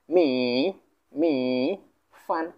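"মি" with a long drawn-out vowel, "ফান" short after a pause; pronunciation demo. Shown as a demonstration. Teacher pronouncing the word together for learners, drawn-out syllables. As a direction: model it slowly, stretching "মি" before "ফান". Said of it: "একসাথে উচ্চারণ করব".

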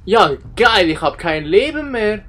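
laute, hektische Panik; panicking gamer. Real, not acted. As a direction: schrei wie ein Spieler kurz vorm Tod. Spieler in Gefahr, Schreie. (panischer Spieler)

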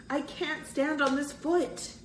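high-pitched, mildly panicked complaint. A narrator's reading. Injured boy telling his friends about his foot, high mild panic. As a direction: let panic lift the voice. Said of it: "revealed his panic".

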